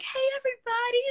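very high, singled-out mock-cheerful hello; hammed-up bubbly welcome; big cheery greeting. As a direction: imitate an over-friendly coach greeting the whole gym. Coach's big cheery entrance retold.

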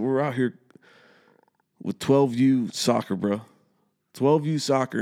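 indignant retold scolding; retold rant. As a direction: shame him over twelve U soccer.

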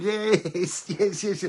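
high, loud celebrating yes; cheering yes. Retold or imitated. Fan and Simone celebrating the goal, loud cheering. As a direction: cheer it like a goal just went in. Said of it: "Simone and I are in the room going".

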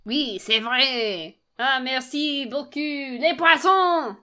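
exaggerated playful French accent; mock French accent. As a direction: ham it up in French, stretching each phrase. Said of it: "sing in a French accent".

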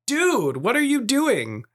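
high-pitched, incredulous dad outburst; exasperated dad. Retold or imitated. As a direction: call out a mock-exasperated challenge to your kid. Dad retelling his exasperated reaction to son.

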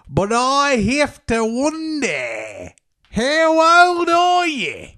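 put-on slow, wildly stretched Australian accent; hammed-up Aussie croon, vowels dragged out; Johnny Australia. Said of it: "with the Johnny Australia voice".

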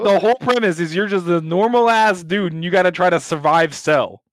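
normal talking voice

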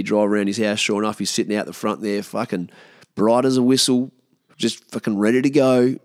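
usual speaking voice

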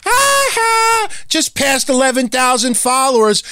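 high, gloating mock brag. Mocking a follower brag, high smug crowing. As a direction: crow it like a smug show-off. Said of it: "go up to J D and say".

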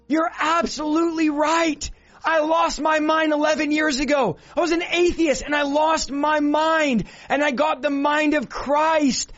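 high-pitched, drawn-out fiery declarations; impassioned preaching. Real, not acted. Preaches at full force.

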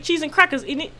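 put-on high-pitched joke British accent; hammed-up British slang voice; mock British accent. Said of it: "Oh, it's some British shit".

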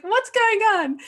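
high, clueless little voice; confused Darcy. Retold or imitated. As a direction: play it sweetly baffled. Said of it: "Darcy's just like".

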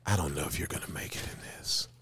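near-whispered, low disapproval; hushed doubt. Retold or imitated. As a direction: deliver the verdict under your breath. Director doubting him, hushed.

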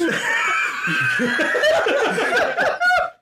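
loud high shrieking laugh. Real, not acted. Burning from pepper, laughing.

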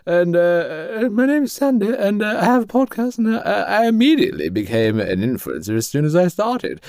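high, chatty put-on voice; mock-modest guest in a high voice; Sandy the influencer. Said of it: "And she".

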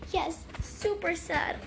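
slow, choked tearful words; crying. Acted. Granddaughter admits to grandpa she's sad, crying. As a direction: fight back the tears as you speak. Holding back tears with grandpa.

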